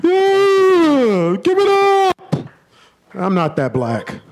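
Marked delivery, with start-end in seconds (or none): 0.0-2.1 high, loud call to the crowd; hyped. Real, not acted. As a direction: pump them up. New comic greets the crowd.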